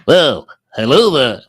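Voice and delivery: loud, booming impression greeting; Rob. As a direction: put on a big, exaggerated voice. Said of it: "exactly what Rob sounds like".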